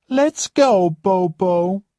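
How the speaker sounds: haughty high-pitched complaining lady; spoilt rich guest. Acted. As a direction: play the pampered diva who expects service. Roxanne leaves with dog.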